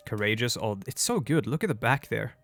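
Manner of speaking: ordinary talk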